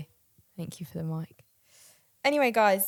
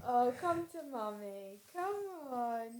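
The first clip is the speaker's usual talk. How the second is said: soft higher coaxing to a puppy; pet talk; 4 semitones higher, 6 dB quieter, slower than usual. Real, not acted. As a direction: coax the dog gently. Calling the puppy.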